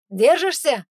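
loud high shout; calling out. A narrator's reading. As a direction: shout it over the engine. Calls over the jeep noise.

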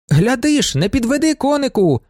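high-pitched excited cry; exclaiming. A narrator's reading. Young lady cheering on the horse, excited exclamation. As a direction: call it out high and urgent. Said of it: "вигукнула панна Ізабелла".

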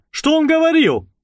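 high, heated question; excited exclamation. Acted. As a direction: demand it all worked up. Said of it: "воскликнул разгорячённый Вернер". (взволнованный возглас)

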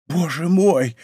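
stunned, wondering exclamation; restrained astonishment; dazed repeating. A narrator's reading. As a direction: say it dazed, just coming round. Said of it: "твердил Планше".

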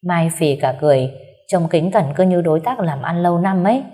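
usual speaking voice

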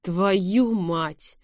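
plain reading voice

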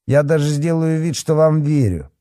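high, mocking fake concession; grinning sarcasm. A narrator's reading. Policeman pretends to believe the kids, grinning sarcasm. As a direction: pretend to agree through a grin. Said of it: "осклабился Валентин".

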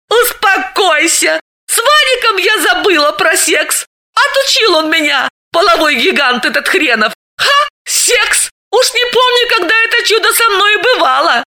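excited. Acted. Galina exclaims about her ex.

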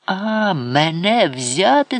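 high, drawn-out outrage; indignant, teeth-gritted question; grinding indignation. A narrator's reading. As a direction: stretch it out in affronted disbelief. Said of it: "скриготнув гострими своїми зубами".

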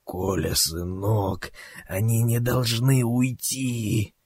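soft, drawn-out plea from a wounded man; dying whisper. A narrator's reading. Wounded captain in Kolya's daydream urges him on, whispering weakly. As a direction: strain it out slowly, as if bleeding out. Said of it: "шепчет ему на ухо".

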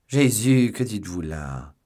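loud startled cry of Jesus; scandalized gasp of a word; shocked exclamation. Acted. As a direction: burst out in shock. Servant shocked at suggestion.

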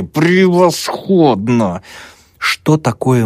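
high, very drawn-out exclamation; exasperated sarcasm. A narrator's reading. Dal despairing at his predicament, exasperated. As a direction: stretch the word out in bitter irony. Student despairs at being tricked.

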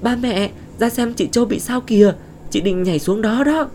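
high, loud, terrified shout for the parents; panicked cry. Acted. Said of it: "kêu lên thảm thốt".

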